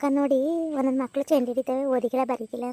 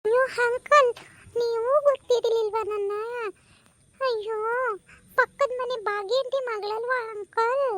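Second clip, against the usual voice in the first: put-on high, pleading girl's voice; higher girlish voice pleading with the uncle; young daughter voice; 7 semitones higher, slower than usual. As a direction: play the teenage daughter coaxing her uncle. Daughter visiting, voiced by mother.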